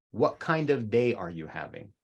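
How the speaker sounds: ordinary talk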